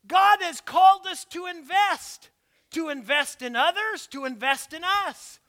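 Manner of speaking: loud, rising preacher's call; fiery preaching. Acted. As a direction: preach it out to the room. Preaching at full force.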